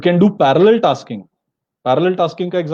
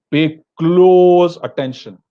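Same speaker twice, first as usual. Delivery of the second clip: "pay close attention" drawn out slow and louder, stress on "close"; slow emphatic urging; 6 dB louder, much slower than usual. Real, not acted. Teacher pressing students to focus, slow and loud emphasis. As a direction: lean on each word so the class listens.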